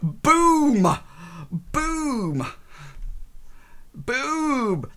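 high playful boom; excited boom. Real, not acted. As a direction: belt out each boom. Shouted game sound.

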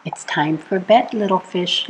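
usual speaking voice

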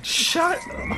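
squeaky laughing protest; high giggle through words. Real, not acted. Laughing at teasing.

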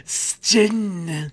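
snaky hiss, then gin; drawn-out s like a snake. Retold or imitated. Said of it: "You gotta imagine him".

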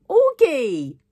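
high, loud scolding call; exasperated cry. A narrator's reading. Girl fed up with her friend's doubting, exasperated.

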